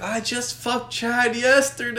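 high, slow girl impression; mimicked girl's confession. Repeating the girl's confession to friends, high mock voice. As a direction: draw her words out high in mockery.

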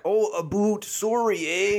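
high-pitched caricature of Canadian speech; mock Canadian accent. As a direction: tease with an exaggerated Canadian accent. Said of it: "Americans ... are like".

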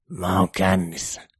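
hidas humalainen ääni; venytä kuin juopunut; humalainen tunnustus. A narrator's reading. Esko humalassa. (hidas, humalainen Esko)

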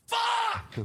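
high-pitched full shout of frustration; one long, high yell; screamed out. Retold or imitated. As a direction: scream the curse in agony. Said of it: "I scream out".

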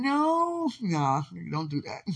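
laughing protest, squealing no; bashful loud no, don't. Real, not acted. Protesting being praised, laughing.

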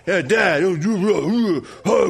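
imitating his son's new grit, understated; gritty son voice. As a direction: play the son trying to sound tough, mildly. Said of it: "got a little more grit in his voice".

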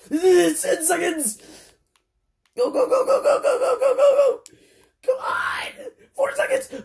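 high frantic shouting against the clock; frantic yelling. Real, not acted. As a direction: scream them on, voice cracking high. Racing the countdown.

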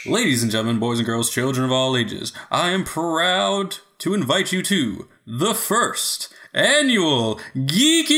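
booming showman announcement, slowing down; ringmaster. Acted. Grand awards-show announcement.

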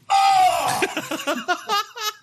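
laughing high and loud; high laugh burst. Real, not acted. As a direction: break into a shrieking laugh. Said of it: "Now you sound like a man getting his leg sawed off".

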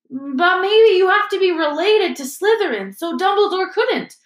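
put-on a boy cutting in excitedly; schoolboy voice. Dean interrupts.

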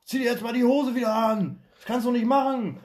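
high, loud mock yell; shouted from the scaffolding. Workers on the scaffold yelling at a colleague phoning her mother, loud mock shout. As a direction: holler it down to a coworker on the phone. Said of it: "da haben wir auch oben vom Gerüst geschrien".

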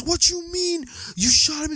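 high, put-on voice of disbelief; incredulous question. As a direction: ask it as if you can't believe your ears. Vincent incredulous at Marvin.

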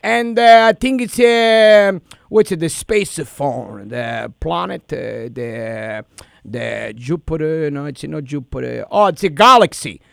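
prank caller in a thick put-on Italian accent. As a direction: play an Italian immigrant dad, hammed-up. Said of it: "but I got a lot of accent".

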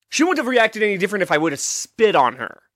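ordinary talk, nothing marked

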